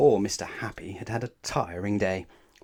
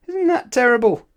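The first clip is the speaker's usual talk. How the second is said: mock horror; 14 semitones higher, 10 dB louder than usual. A narrator's reading. Narrator mock-horrified at prank.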